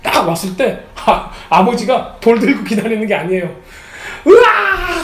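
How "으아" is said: high, loud cry of joy; father's cry. Retold or imitated. Father shouting as he runs to his returning son, high loud cry. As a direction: yell out as you run to your son. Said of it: "하면서 막 소리치면서 달려드는 거예요".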